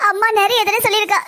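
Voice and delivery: ordinary talk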